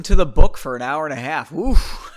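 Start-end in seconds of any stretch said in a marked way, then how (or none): 1.5-2.2 loud oof breaking into laughter; real amused oof and laugh; oof then laugh. Real, not acted. Reacts to long episode.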